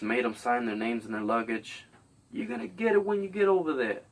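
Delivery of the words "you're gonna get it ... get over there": higher, sly fake-friendly guard voice; lightly voiced lying guard; mock Nazi guard.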